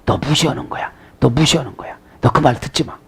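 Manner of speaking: hushed, conspiratorial whisper; 이간질하는 속삭임. Acted. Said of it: "뭐라고 이간질할까요". (이간질하듯 낮게 소곤소곤)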